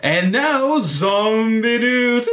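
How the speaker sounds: slow, high news-anchor intro; announcer voice. Retold or imitated. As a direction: announce it like a TV newsreader. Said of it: "I wanna say every time when we're doing zombies".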